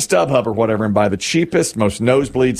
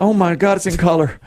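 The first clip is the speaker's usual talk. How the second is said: put-on childlike wonder; 7 semitones higher than usual. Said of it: "It's like".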